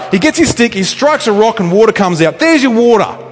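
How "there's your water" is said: higher, louder fed-up retort; exasperated snap. Retold or imitated. Moses answering the whinging people, higher, louder and exasperated. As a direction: throw it at them, out of patience. Moses striking the rock angrily.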